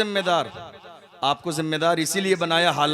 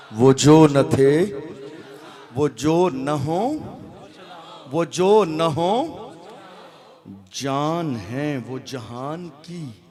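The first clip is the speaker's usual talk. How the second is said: very slow, drawn-out recitation; slow recited verse; much slower than usual. Acted. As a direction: savour each repeated line. Quoting imam's verse slowly.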